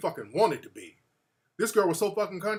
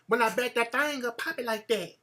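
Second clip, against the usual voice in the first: put-on country girl voice; 2 semitones higher, 5 dB quieter than usual. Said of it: "talking that country".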